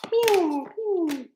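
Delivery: high, falling mewing; cat meow. Pretend. Reader meowing before the card pull, high cat imitation.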